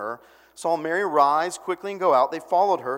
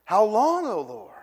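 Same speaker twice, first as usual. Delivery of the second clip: raised cry on long; mild lament cry; 5 semitones higher, 5 dB louder than usual. Retold or imitated. Pastor quoting the psalm, raised cry. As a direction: call out to God. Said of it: "when people really lament".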